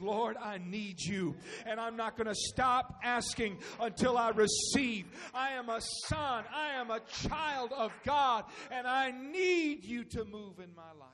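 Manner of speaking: loud, pleading prayer at full preaching force; fervent prayer. Acted. Preacher to congregation, modelling prayer in a loud fervent plea. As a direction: cry out to God, refusing to stop.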